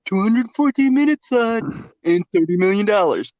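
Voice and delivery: lightly mimicked fanboy; a hint of a smug fanboy; Snyder fan voice. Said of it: "the only two arguments I've gotten".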